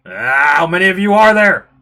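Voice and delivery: loud bellow of complaint; exasperated shout. Acted. Complaining at wet guests piling in, exasperated shout.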